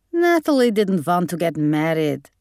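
put-on Hungarian-accented snap from Gran; snapping, Hungarian accent. A narrator's reading. Gran defending Natalie to Mum, snapped Hungarian accent. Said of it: "snapped Gran".

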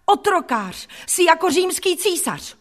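high, indignant outburst; outraged, defiant girl; crying out accusations. A narrator's reading. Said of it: "vykřikla jsem".

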